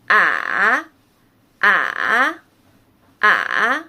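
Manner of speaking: ah dipping low then rising back up; third tone. Shown as a demonstration. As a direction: drop "ah" low, then bring it up. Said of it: "It's go down and up".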